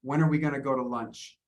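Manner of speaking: "When are we gonna go to lunch" run together, weak words squashed; reduced connected speech. Shown as a demonstration. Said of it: "I'm reducing this".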